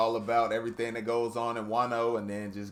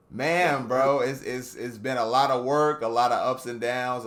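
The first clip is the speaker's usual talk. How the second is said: excited; 3 semitones higher, 7 dB louder than usual. Real, not acted. Excited fan outburst.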